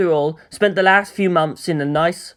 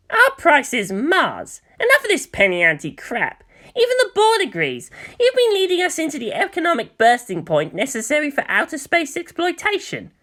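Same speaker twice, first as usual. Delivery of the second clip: character voice; 6 semitones higher than usual. Acted. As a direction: press the demand for Mars. Character voice in read dialogue.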